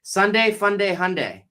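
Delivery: Hyundai as HUN-day, rhymed with Sunday; high singsong Sunday, Fun Day, Hyundai; rhyming pronunciation. Shown as a demonstration. Host shows how he remembers it, rhyming Hyundai. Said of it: "didn't know how to pronounce".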